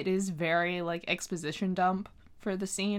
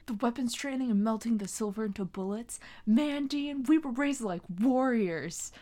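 higher-pitched imitation of an overwrought TV line; mock dramatic line; 4 semitones higher than usual. Podcast host quoting Sam's warriors line to co-host, mock dramatic. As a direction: recite it with hammed-up exasperation at Dean. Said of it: "that line delivery always makes me, like, crack up".

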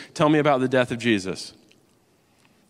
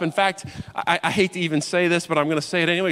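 normal talking voice

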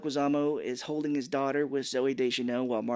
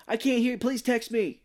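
high mock shout into the phone; playfully hollering down the line; pretend bad connection; 10 semitones higher, 3 dB louder than usual. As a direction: put on a can't-hear-you phone yell. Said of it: "pretending like".

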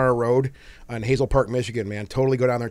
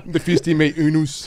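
mock French; 2 semitones higher than usual. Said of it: "my French".

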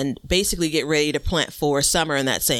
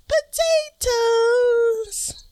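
very high, long-held playful potatoes; hammed-up high singsong; much slower than usual. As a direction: stretch it out high like a mock chant. Hammed-up repeat of the reveal.

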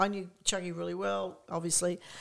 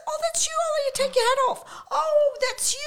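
very high, loud squeal; shrieky mock amazement; astonished realisation; 8 dB louder than usual. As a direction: act out finally recognising a famous friend. Acting out her sudden realisation.

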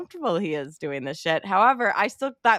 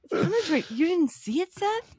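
much higher, whiny indignation; offended whine; 11 semitones higher than usual. Retold or imitated. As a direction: sound personally insulted. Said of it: "He's, like, so offended".

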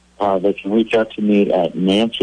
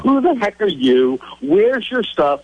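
high, insistent Secret Service grilling; acted-out agent demanding answers; interrogating agent; 9 semitones higher than usual. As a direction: fire off demands, pitched well up.

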